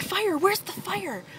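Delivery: high, slowed mock-panic shout; imitating panicked dad. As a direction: act out a dad frantically hunting for danger. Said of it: "he's like".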